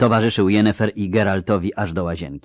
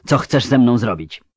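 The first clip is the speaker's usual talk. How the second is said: hissed; 5 semitones higher, 5 dB louder than usual. Acted. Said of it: "zasyczała".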